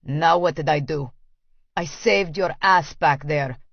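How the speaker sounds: exasperated Russian man. Acted. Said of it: "then says".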